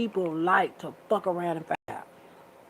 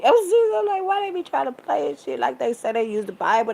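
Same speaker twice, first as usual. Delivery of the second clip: exasperated; 7 semitones higher, 7 dB louder than usual. Real, not acted. Venting about hypocrites.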